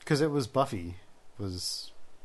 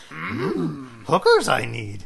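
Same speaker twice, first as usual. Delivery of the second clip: slow, loud, hammed-up creature voice; bad imitation of a little alien sage, drawn out; Yoda; 6 dB louder, much slower than usual. Joking to the friend as a crude Yoda, slow hammed-up voice. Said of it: "Yoda on coke".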